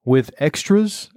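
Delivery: slow, hesitant worried question; anxious. A narrator's reading. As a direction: ask with a little worry. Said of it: "a little anxiously".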